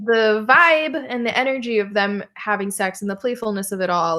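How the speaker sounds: ordinary delivery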